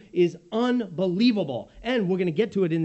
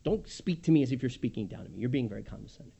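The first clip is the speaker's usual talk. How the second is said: mock resentful; 7 semitones lower, 5 dB quieter, faster than usual. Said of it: "what we mean by it is".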